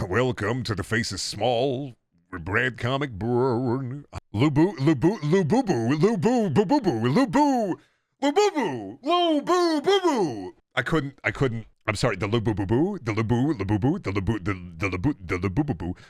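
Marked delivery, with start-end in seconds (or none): 4.3-10.5 high rising nonsense babble; cartoon babbling voice; silly singsong gibberish. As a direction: climb higher with each lubu.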